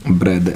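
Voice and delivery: ब्रदर as "bra-duh", R dropped; silent final R. Shown as a demonstration. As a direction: end ब्रदर on an open vowel. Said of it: "ईआर का उच्चारण नहीं होता".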